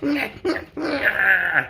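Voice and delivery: very high, squawked refusals; mock villain noise. Storyteller mimicking the trash-talker, high squawking voice.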